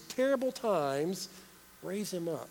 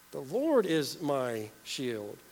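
slow emphatic; 6 semitones lower, much slower than usual. Acted.

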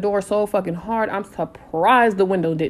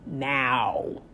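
slow, softer, icy command; quiet fury, every word weighed; cold demand; 3 semitones lower, 5 dB quieter, much slower than usual. Retold or imitated. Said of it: "and I said".

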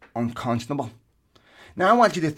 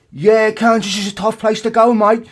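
loud, higher, terrible mock English accent; bad English accent; 7 semitones higher, 8 dB louder than usual. Imagining Beckham at the Super Bowl, bad English accent. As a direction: put on a hammed-up English pundit's accent. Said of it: "that's my worst English accent ever".

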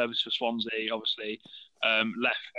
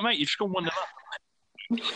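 higher, slow, hammed-up pleading; mock exasperated reply; 7 semitones higher, slower than usual. Podcaster answering the manager, mock exasperated. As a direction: tell him to calm down, slowly and much higher.

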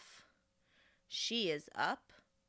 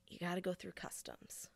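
whispered, hushed; whispered aside; 10 dB quieter than usual. Real, not acted. To the viewers, whispering about customs. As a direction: tell them like a secret.

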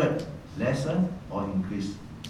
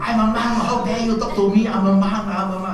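mock haughty monk, higher and louder; ego monk; 9 semitones higher, 5 dB louder than usual. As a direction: puff yourself up as a proud monk. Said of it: "ego monk come up".

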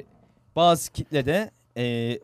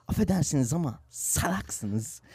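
slow imitation of an actor's accented Turkish; slower than usual. Co-host quotes the actor insulting maskless people, accented imitation. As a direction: put on an accent, drawn out slowly. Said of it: "Salağa bile aksanlı söylüyor".

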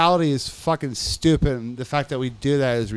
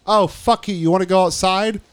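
louder, higher imitation of an angry guard; mock challenge; 4 semitones higher, 6 dB louder than usual. Guard daring inmate to go outside, mock challenge. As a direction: throw down a challenge.

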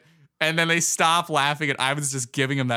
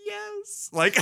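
high mock shout of yes breaking into a laugh; gleeful Ivan. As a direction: squeal a triumphant yes, then laugh. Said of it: "Ivan's like".

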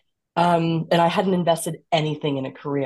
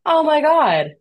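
excited realisation; 11 semitones higher, 7 dB louder, slower than usual. Retold or imitated. Retold moment of realisation.

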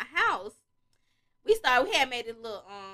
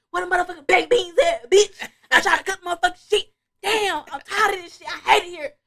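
high, loud, whiny rant; drunk meltdown; 7 semitones higher, 5 dB louder than usual. Retold or imitated. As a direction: break down over beans. Drunk meltdown over beans.

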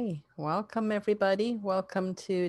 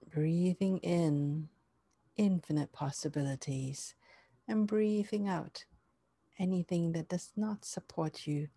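guided meditation; 3 dB quieter than usual. Acted.

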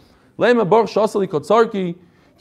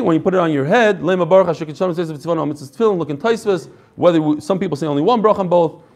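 ordinary talk, nothing marked

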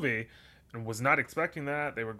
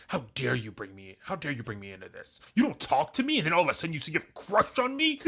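mock angry Katniss; 3 semitones higher than usual. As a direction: play her indignant, pitch climbing.

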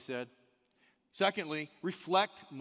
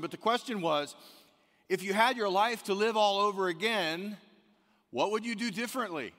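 loud, raised preaching voice; 4 semitones higher, 8 dB louder than usual. Acted. Pastor telling the church about the study, preaching loudly. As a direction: build it up like a pulpit point. Opens sermon with study story.

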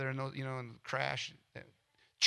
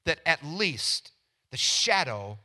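slow, deliberate "at least" leaned on; stress on at least; 3 dB louder, slower than usual. Shown as a demonstration. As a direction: drag out "at least" so each word lands. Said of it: "did you hear that word?".

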